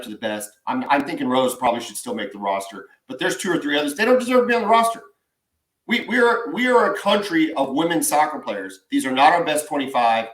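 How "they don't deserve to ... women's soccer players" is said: fast rant. Real, not acted. Ranting about roster picks.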